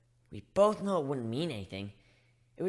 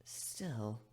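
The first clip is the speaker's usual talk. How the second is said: a single breathy word, no voice; whispered; 2 semitones lower, 8 dB quieter than usual. Acted. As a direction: let it slip out as a hushed whisper. Hesitant afterthought after pleading.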